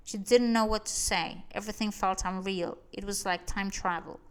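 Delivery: ordinary delivery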